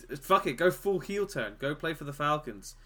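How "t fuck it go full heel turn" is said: ordinary talk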